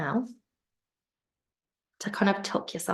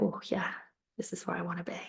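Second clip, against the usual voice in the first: contented inner thought; 8 dB quieter, much faster than usual. Pretend. Voicing the listener's contented thought.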